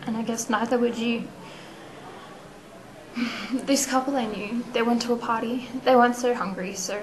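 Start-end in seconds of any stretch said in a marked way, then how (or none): 2.7-3.5 short, soft sigh. Acted. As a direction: let out a quiet breath before going on. Pause before next sad story.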